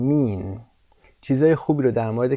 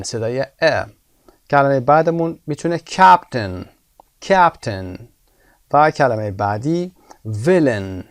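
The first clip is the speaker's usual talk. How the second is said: ordinary talk, nothing marked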